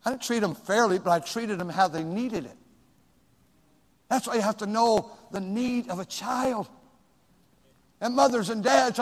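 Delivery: ordinary talk, nothing marked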